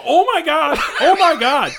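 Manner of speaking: high, loud, laughing panic; panicked oh my God. Retold or imitated. Telling his buddy about the mess, high laughing panic. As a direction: squeal it in retold horror.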